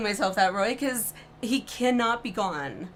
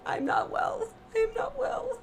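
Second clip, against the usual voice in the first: high, whispered, crying I'm not well; anguished wail; 12 semitones higher, 5 dB quieter than usual. Real, not acted. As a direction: sob it out, broken. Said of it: "Somebody asked me that".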